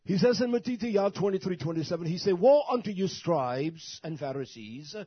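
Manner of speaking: high, loud scripture denunciation; shouted, drawn-out pulpit condemnation; full-force preaching. Real, not acted. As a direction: cry out the rebuke, stretching the key words.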